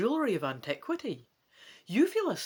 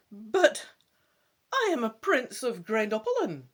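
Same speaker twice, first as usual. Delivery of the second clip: rising, louder indignant protest; a prince's voice climbing in outrage; voice rising in complaint; 5 semitones higher, 6 dB louder than usual. A narrator's reading. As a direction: protest like a stung young prince. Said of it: "your voice rising".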